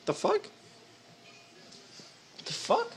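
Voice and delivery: high, baffled disbelief; shocked and confused; surprised. Real, not acted. Shocked the pellet vanished.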